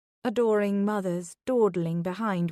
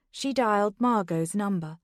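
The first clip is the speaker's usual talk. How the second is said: ordinary delivery, nothing marked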